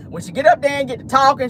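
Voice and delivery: ordinary talk, nothing marked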